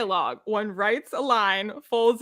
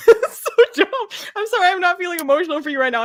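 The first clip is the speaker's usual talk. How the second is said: high, loud, laughing through the words; 10 semitones higher, 7 dB louder, faster than usual. Real, not acted. Fan laughing at a dumb scene, high loud laughter. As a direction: crack up while talking, fast. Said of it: "I'm laughing".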